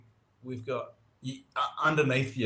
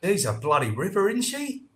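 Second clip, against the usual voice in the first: broad Aussie brag, higher and louder; hammed-up Aussie; 9 semitones higher, 5 dB louder than usual. Shown as a demonstration. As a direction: crow it like a proud Aussie bloke. Showing off Aussie slang.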